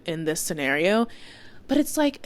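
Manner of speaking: usual speaking voice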